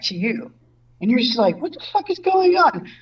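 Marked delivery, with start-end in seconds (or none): 1.6-2.9 mock bewildered. Mock confused couch viewer.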